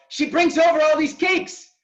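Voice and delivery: loud, high, teasing grumble; mock complaint. Coach teasing Ariel about her cakes, loud mock complaint. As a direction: tease her like a fake accusation. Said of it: "I'm totally exaggerating".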